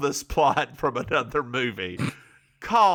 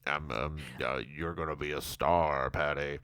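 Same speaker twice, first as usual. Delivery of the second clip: low character voice; 11 semitones lower, 9 dB quieter than usual. Retold or imitated. Voicing Barry's speech.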